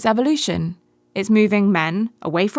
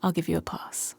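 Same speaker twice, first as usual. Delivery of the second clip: whisper; 7 dB quieter than usual. Acted. Hushed dorm banter.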